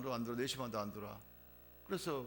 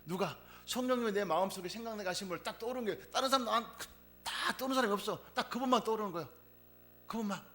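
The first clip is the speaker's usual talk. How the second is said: higher, louder fervent preaching; preaching at full force; 7 semitones higher, 6 dB louder than usual. Acted. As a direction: proclaim it with fervour. Preaching climax. (열정적으로 높고 크게 설교하듯)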